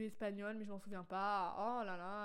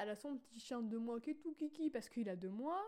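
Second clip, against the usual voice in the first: usual speaking voice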